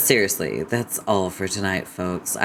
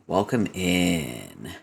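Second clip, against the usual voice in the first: slow, softer welcome with rising tune; soft low welcome; 2 semitones lower, 4 dB quieter, much slower than usual. Real, not acted. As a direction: stretch welcome in and lift the end. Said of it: "welcome in repeated".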